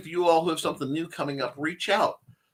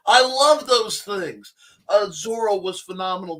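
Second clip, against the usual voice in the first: excited; 6 semitones higher, 5 dB louder than usual. Real, not acted. Enthusiastic about old serials.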